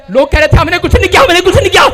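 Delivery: rapid, rising, flustered protest; mock the mourners' tense pleading innocence; panicked denial. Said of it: "उन्हें tension हो गई". (घबराकर जल्दी-जल्दी सफ़ाई देते हुए)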